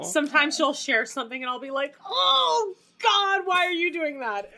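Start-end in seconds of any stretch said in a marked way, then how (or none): 2.1-4.5 high, loud exasperated groan; exasperated at grandma's posts. Retold or imitated.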